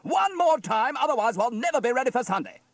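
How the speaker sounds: high, loud exasperated rant; barking exasperation. A narrator's reading. Steele scolds his tuneless band, barking. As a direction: berate the band at full voice. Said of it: "he barked".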